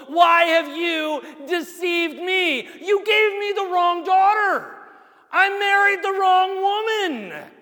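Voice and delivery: high, loud, slow accusing shout; Jacob's furious betrayed outcry; outraged shout. Acted. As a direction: shout it at the uncle who tricked you. Jacob rebukes uncle, acted loudly.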